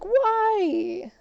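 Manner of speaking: high, loud pleading whine; a teen's outraged whine; whiny why. Retold or imitated. To staff refusing German, a high whiny why.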